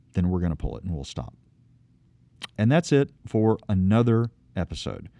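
normal talking voice

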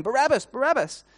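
high, loud repeated chant; crowd calling out. Acted. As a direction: chant the name like a whole crowd. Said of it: "whole crowd is calling out your name".

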